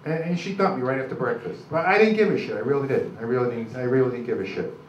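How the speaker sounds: ordinary delivery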